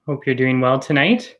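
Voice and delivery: normal talking voice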